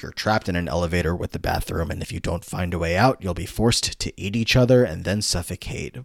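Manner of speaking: low, menacing villain voice; evil distorted villain. Acted. Sue giving the trapped boys a choice, evil villain voice. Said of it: "in this very evil, distorted voice".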